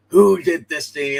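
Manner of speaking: sudden loud outraged question; loud demand. Retold or imitated. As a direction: bark the question loudly. Batman quote yelled in retelling.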